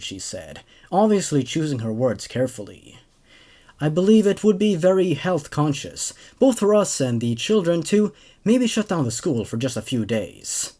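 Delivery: steady, even storytelling read; story narration. A narrator's reading. Host reading a creepypasta to his viewers, calm narration. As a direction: carry the tale along calmly.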